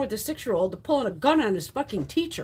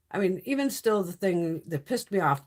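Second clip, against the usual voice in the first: ordinary talk, nothing marked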